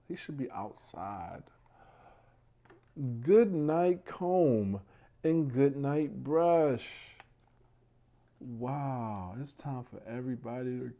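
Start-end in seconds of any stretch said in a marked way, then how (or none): 3.2-7.2 slow, louder storybook read-aloud; drawing out each rhyming line; bedtime story reading. Acted. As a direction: read it out like a picture book for little ones.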